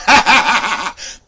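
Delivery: loud laugh. Real, not acted. Laughs after his boast.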